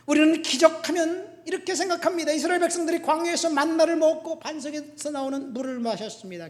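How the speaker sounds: loud, high preacher's delivery; forceful preaching. Real, not acted. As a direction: drive it home to the congregation.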